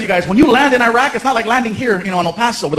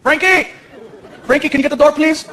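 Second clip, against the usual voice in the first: higher-pitched call to a son; 6 semitones higher than usual. Acted. Dad calls Frankie to get the door, calling out. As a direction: call across the house.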